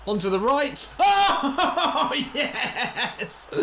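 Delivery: his winger scores, shouting yes; ecstatic yell. Real, not acted. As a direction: scream with joy at the goal. Goal scored.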